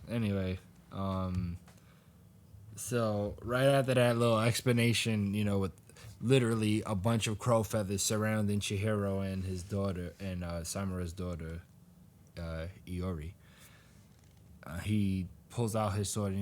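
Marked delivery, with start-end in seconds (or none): none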